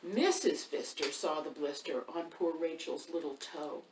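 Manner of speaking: ordinary delivery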